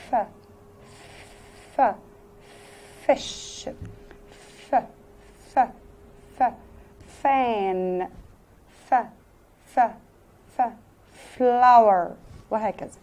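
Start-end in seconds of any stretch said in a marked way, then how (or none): 0.1-10.6 ف as a breathy unvoiced f hiss, repeated; teacher drilling the f sound; f sound demo. Shown as a demonstration. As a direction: blow out a soft f through the teeth. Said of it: "الإف الساوند بتاعه".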